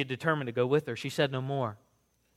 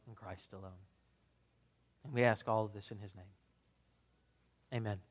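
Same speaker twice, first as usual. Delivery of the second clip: soft, hushed prayer voice, winding down; humble prayer, fading to almost nothing at the end; quiet prayer; 4 semitones lower, 5 dB quieter than usual. Acted. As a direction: pray it gently to the congregation. Pastor leads a prayer.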